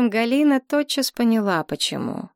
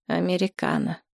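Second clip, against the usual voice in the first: dead voice. A narrator's reading. Polina orders coffee after being cornered, dead-voiced. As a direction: answer as if numb. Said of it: "мёртвым голосом отозвалась Полина".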